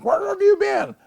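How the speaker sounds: high, loud worried mother; mother's voice. Retold or imitated. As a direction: call out like an anxious mother. Voices his mother's question.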